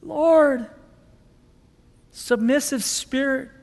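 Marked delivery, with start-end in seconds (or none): 0.0-0.7 hurting plea. Retold or imitated. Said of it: "She's hurting".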